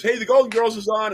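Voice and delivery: high, loud imitated call; mom yelling upstairs. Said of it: "my mom would yell upstairs".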